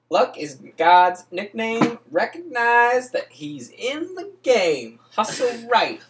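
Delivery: dramatic reading. Acted. Reading the hustler verses to his friend, preaching high. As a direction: proclaim every line like a sermon.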